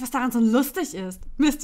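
usual speaking voice

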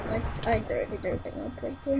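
ordinary talk, nothing marked

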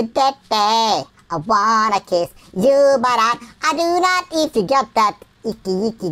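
usual speaking voice

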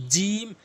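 firm j and held "ee" in "জিম"; contrast drill. Shown as a demonstration. Teacher contrasting two letters, long high "জিম". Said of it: "জিহ্বার মাঝখান থেকে".

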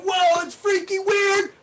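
mock wow at the crossing rhythm; mock amazement. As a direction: play the freaked-out student. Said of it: "you're gonna be like".

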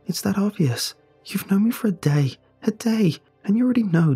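choking up. Acted. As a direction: fight back tears as you speak. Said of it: "She chokes out her words".

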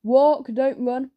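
usual speaking voice

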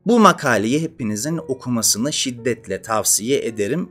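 ordinary talk, nothing marked

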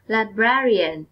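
librarian with the second syllable, brar, stressed and clearly stretched. Shown as a demonstration. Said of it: "các em nhấn mạnh vào âm tiết số hai".

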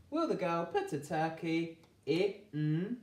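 put-on slow, each word stretched for children; a teacher stringing the sounds together; slow blending. Shown as a demonstration. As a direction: piece it together slowly. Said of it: "blending the sentence slowly".

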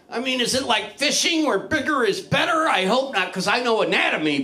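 normal talking voice

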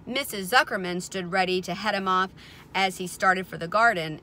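ordinary delivery, nothing marked

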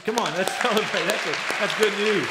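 a joyful laughing call to the crowd; excited rally. Real, not acted. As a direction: pump the room up to celebrate. Pastor rallying the congregation to celebrate.

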